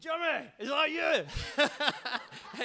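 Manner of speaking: hammed-up drunk pal spotting an old friend; drunk Scotsman. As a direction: ham up a staggering drunk on a train. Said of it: "staggered up and was like".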